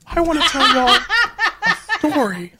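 high, laughing storyteller voice; giggly high lady voice. Acted.

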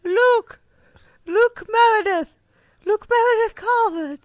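shouted announcement. Real, not acted. Coworker loudly proclaiming the baby's full name.

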